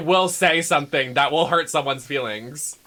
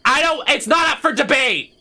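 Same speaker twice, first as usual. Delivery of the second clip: indignant outburst; 8 semitones higher than usual. Acted. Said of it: "I'm so mad about it".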